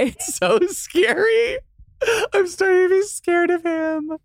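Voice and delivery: hammed-up high scared voice; mock fear. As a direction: squeal it in fake fright.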